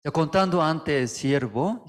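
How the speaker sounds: usual speaking voice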